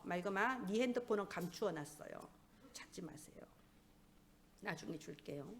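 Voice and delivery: low, hushed aside off the sermon; quiet aside. Real, not acted. Preacher tells someone their phone is put away, quiet aside.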